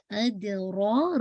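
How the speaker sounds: ادرا with a full, heavy ر; slow recitation demo. Shown as a demonstration. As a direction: say ادرا slowly, ra thick. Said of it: "را کو heavy رکھیے گا".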